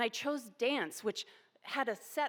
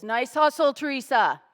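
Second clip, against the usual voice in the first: louder, higher praise called across court; coach's shout of praise; 4 semitones higher, 12 dB louder than usual. Retold or imitated. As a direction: cheer her on from the sideline.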